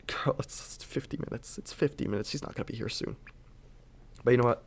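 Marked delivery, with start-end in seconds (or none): 0.1-3.2 a sarcastic whispered aside about the wait. Retold or imitated. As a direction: mutter it so the nurse can't hear. Muttered aside about the nurse.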